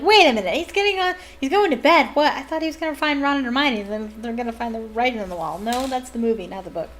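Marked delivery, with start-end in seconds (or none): 0.0-5.6 higher, louder, fast baffled rush; confused surprise. Retold or imitated. Reader thrown by the skipped chapter, fast baffled exclaiming. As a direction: blurt it out in disbelief. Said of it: "I was like".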